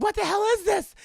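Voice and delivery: high, loud mock bewilderment; shocked outburst. Imitating Liv seeing lost souls in limbo, high shocked yell. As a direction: blurt it out, hammed-up freak-out.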